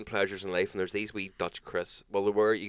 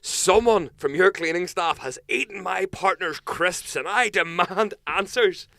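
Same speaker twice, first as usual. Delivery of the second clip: high, loud, slow indignant demand; hammed-up furious guest; pompous demand for answers at the front desk; mock demand; 11 semitones higher, 8 dB louder, slower than usual. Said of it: "It's like".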